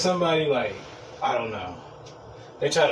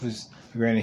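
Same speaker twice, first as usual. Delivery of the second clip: usual speaking voice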